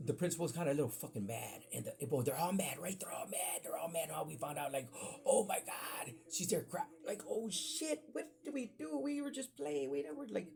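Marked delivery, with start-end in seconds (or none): 5.3-10.5 high-pitched mock panic; retold panic. Kids caught teasing, protesting to angry adults, retold panic. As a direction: plead high like a kid just caught.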